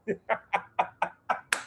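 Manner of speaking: silent airy laugh; breathy laugh. Real, not acted. Laughs at bank account offer.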